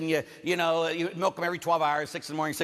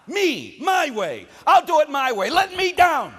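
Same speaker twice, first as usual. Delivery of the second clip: defiant shout; 11 semitones higher, 9 dB louder, slower than usual. Acted. Voicing the sinner's demand.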